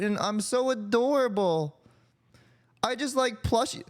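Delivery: squeaky put-on cute voice, teasing a friend; mock cutesy voice. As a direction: play the tiny adorable one, hammed up. Mocking a cute small persona.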